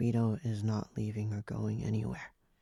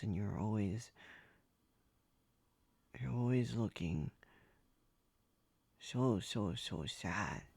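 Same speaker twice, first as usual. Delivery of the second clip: mildly sad; 2 semitones lower, 7 dB quieter than usual. Acted. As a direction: speak quietly with sorrow for them. Describing master's daily sadness.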